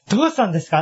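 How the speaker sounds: concerned startled question. Acted. To someone who suddenly burst into tears, concerned startled question. Acting out a worried listener's question.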